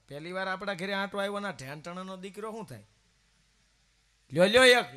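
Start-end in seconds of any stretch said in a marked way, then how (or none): 4.3-4.9 loud coaxing offer of sweets; urging offer. Retold or imitated. Mother-in-law urging son-in-law to eat, loud coaxing. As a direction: press the laddoo on him loudly.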